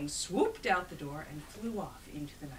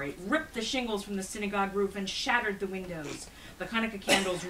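ordinary delivery

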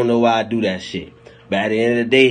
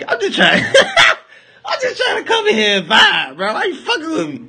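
hammed-up cry, pitched way up; mock crying plea. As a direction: wail it like a wronged kid. Acted pleading complaint.

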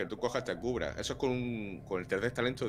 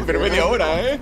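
laughing; 9 semitones higher, 13 dB louder than usual. Real, not acted. Laughing after the kill.

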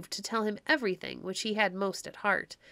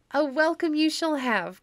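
higher and louder eager cry; 7 semitones higher, 5 dB louder than usual. Acted. Baucis eagerly welcomes her guests, crying out higher and louder. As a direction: call out the welcome gladly. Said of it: "cried Baucis".

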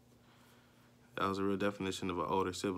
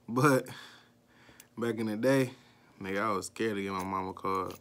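ordinary talk

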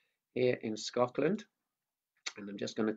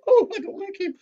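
much higher squeaky puppet; 11 dB louder than usual. Acted. Mickey the puppet cutting in, high-pitched. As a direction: make the puppet pipe up.

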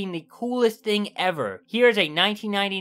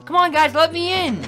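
pleading; 11 semitones higher, 5 dB louder than usual. Pretend. Voices first-time playthrough.

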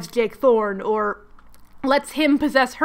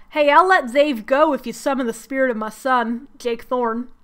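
bad Southern accent. Lucifer offering a deal, in a bad Southern accent.

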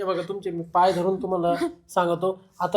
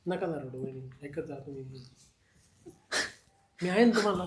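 comforting; 8 semitones higher than usual. Acted. Consoling crying woman.

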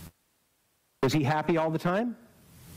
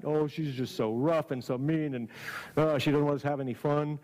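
mocking complaint. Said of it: "have you ever heard people say".

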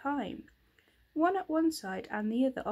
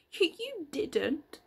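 sobbing; 6 semitones higher, slower than usual. A narrator's reading. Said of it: "sobbed Franny".

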